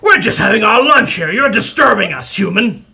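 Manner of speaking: loud, high grumpy troll; annoyed troll. Acted. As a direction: shout like a troll interrupted at lunch. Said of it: "another one of the trolls says".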